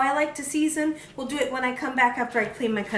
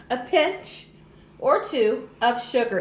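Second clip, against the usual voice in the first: ordinary talk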